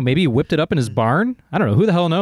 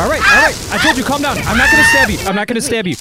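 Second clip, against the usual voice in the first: very high, loud frantic pleading; frantic squawking promise; panicked reassurance; 10 semitones higher, 4 dB louder, faster than usual. Acted. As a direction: try to calm a friend while panicking yourself.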